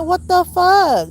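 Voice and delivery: high, slow, drawn-out what the fuck; stunned disbelief. Retold or imitated. Telling viewers about the lease, a high, slow, stunned what the fuck. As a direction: stretch it out in shocked disbelief. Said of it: "I'm like".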